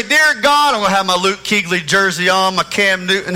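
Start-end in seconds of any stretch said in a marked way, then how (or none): 0.0-0.7 echoing stadium announcer. Shown as a demonstration. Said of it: "Here's what I'm gonna pray".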